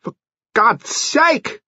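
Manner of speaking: high, desperate plea; frantic imploring. A narrator's reading. Mister Finn begging Lady Lacklander to keep silent, frantic. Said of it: "implored her frantically".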